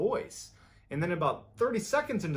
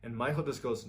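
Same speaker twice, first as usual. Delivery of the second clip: usual speaking voice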